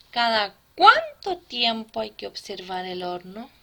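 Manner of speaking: "cuánto" punched loud in the question; stress on cuánto. Shown as a demonstration. Teacher reads the first exercise as an example, stressing "cuánto". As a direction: lean hard on the first syllable of "cuánto". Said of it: "La sílaba tónica".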